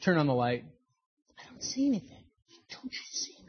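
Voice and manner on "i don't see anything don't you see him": frightened whisper. Retold or imitated. Wife insists in terror.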